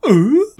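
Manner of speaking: high, loud questioning "ooh"; mock a character's high-pitched ooh; imitated "ooh". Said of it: "looks like he's going".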